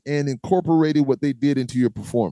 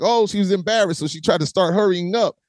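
ordinary delivery

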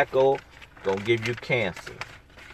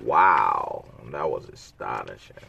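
dry, low mock amazement; sarcastic; 11 semitones lower than usual. Real, not acted. Reacting to the water bill, sarcastic mock wonder. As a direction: fake being impressed, deadpan and low. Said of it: "Excuse my sarcasm".

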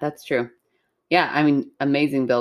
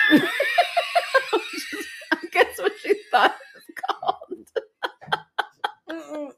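high, helpless laughter through the words; laughing hard; 11 semitones higher, 3 dB quieter, slower than usual. Real, not acted. Reader amused by the bitch sister title, laughing helplessly. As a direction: try to talk while cracking up.

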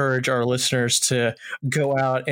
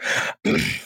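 clearing throat. Real, not acted. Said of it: "Let me start that over".

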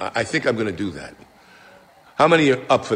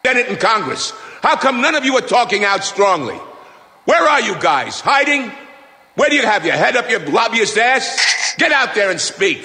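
shouting; 10 semitones higher than usual. Real, not acted. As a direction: yell at Congress to speak up. Caller shouting at politicians.

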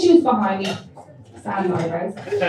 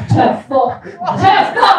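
indignant outburst; 7 semitones higher, 3 dB louder, much slower than usual. Retold or imitated. Indignant retort ending the racism story.

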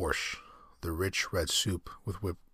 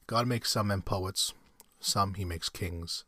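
slow; 2 semitones higher, slower than usual. Acted. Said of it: "general quotes his father".

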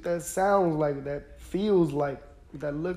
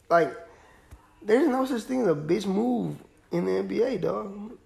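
ordinary delivery

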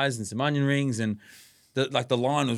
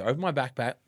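ordinary delivery

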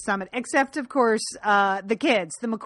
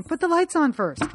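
raised pitch, mild mock yell; mock scream; 7 semitones higher than usual. Host voices exasperated viewers screaming at the TV, mock scream. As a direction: imitate viewers yelling at the screen. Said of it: "we're all screaming".

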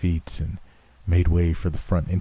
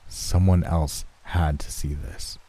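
ordinary delivery, nothing marked

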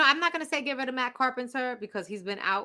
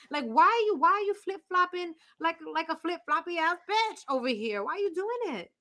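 exasperated rant; 7 semitones higher than usual. Real, not acted. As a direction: vent at the team, voice climbing. Angry rant about team.

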